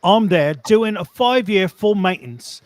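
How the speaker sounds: put-on Cockney storytelling; Cockney accent. Reading a tradesman's story on request, Cockney accent. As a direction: read it like a London Cockney. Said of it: "do it in a British accent".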